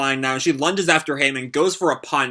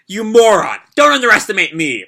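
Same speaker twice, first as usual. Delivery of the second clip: high, loud indignant outburst; angry shout; 6 semitones higher, 6 dB louder than usual. Acted. Fighter scorning an underestimating enemy, shouting angrily. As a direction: yell it at a foe who doubts you.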